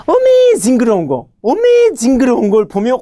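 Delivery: squealed mock horror at something startling; exaggerated shock. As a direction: act out a hammed-up startled reaction. Acting out a mnemonic pun.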